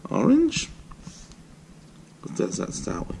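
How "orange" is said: high, rising mock-surprised question; mock surprise. Parent to toddler who says orange, mock surprise. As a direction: act amazed at the answer. Parent queries child's wrong colour.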